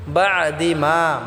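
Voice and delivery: বাদি মা said very slowly, the মা drawn out one alif; stretched vowel. Shown as a demonstration. Teacher demonstrating the one-alif stretch to students. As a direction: hold the আ of মা long and slow. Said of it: "মাটা আমরা এক আলিফ টেনে পড়ব".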